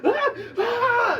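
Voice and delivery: shrill dying shriek, high and loud; death cry. A narrator's reading. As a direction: scream out as the creature dies. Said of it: "as it screams and dies slowly".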